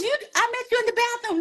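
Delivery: high, fast, playful Whitney voice; quick and high, the way Whitney talked; [name] impression. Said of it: "Now you know how she talked".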